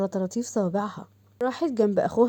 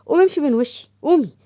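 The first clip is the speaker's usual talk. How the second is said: loud, higher-pitched order to get out; annoyed scolding; 5 semitones higher, 6 dB louder than usual. Acted. Father ordering his cheeky daughter away, annoyed and loud. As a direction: send her off with irritated force.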